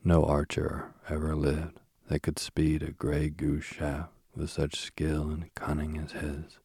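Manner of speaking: usual speaking voice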